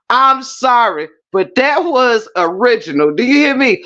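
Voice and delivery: ordinary talk, nothing marked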